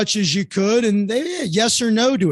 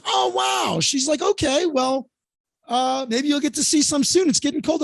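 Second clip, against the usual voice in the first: higher mock Robin voice; imitating Robin; 6 semitones higher than usual. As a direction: lightly send up Robin's reaction. Robin reacting to Florida boy.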